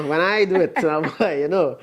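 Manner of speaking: laughing it off; a laugh breaking through the words; chuckling while explaining. Real, not acted. Laughing about own reply.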